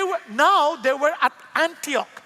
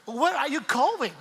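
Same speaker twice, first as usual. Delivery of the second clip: slow, mock posh British English; lightly put-on British accent. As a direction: imitate a British traveller politely asking. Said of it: "Mga ganun po yung style nila kasi British".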